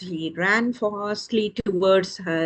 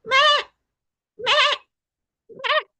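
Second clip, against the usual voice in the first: very high imitated bleat; short, high goat bleats; 3 dB louder than usual. Storyteller makes the sound the chick heard, a high bleat.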